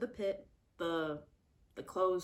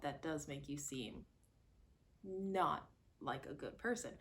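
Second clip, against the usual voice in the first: ordinary talk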